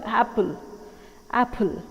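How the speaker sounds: apple with weight on ap; stress on first syllable. Shown as a demonstration. Teacher repeating correct apple. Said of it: "The stress is on the first syllable".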